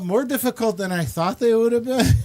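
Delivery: ordinary talk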